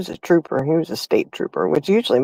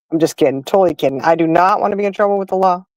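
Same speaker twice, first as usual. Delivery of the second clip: ordinary talk, nothing marked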